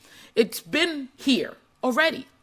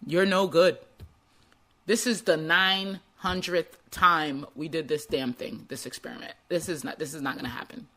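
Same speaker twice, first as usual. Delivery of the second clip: much lower and softer, grumbling doubt; defeated ego voice; 8 semitones lower, 4 dB quieter than usual. Acted. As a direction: sink into discouraged self-talk. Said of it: "imagine what his ego was saying".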